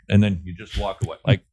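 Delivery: ordinary talk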